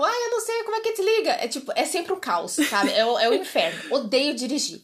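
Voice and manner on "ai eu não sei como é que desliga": very high, fast mock-frantic cry; retold panicked yell. Yelling alone in the car over wrong button, mock panic. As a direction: squeal it in flustered panic. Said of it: "eu gritando dentro do carro".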